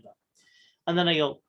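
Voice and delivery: ordinary talk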